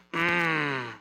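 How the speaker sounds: low, drawn-out knowing hum; a slow, sage murmur from an old believer; mock spiritual elder humming; wise old saint. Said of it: "you're kinda old and you talk slow and you say things like".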